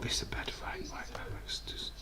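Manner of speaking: whispered aside, unvoiced; hushed aside under the breath. Real, not acted. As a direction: drop to a whisper to yourself. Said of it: "aside under breath".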